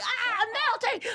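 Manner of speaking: high laughing ah; high laughing cry; laughing panic. Retold or imitated. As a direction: squeal in embarrassment. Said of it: "I'm like".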